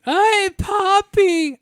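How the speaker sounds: high, slow squeaky greeting. Retold or imitated. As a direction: stretch it out in a squeaky voice. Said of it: "I gotta stop that".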